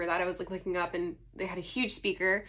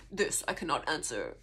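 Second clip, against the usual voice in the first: low, softer doctor voice; mock-solemn doctor, pitched down; imitating the doctor; 6 semitones lower, 5 dB quieter than usual. Doctor declining a patient's question, imitated low and soft. Retelling surgeon's reply to her question.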